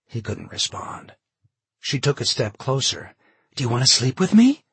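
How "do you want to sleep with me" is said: breathy whisper. A narrator's reading. Carol propositions John desperately.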